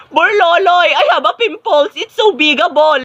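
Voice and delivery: high-pitched overblown dismay; melodramatic distress. Acted. Student telling Burloloy about a pimple, melodramatic distress. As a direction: wail it as a huge disaster.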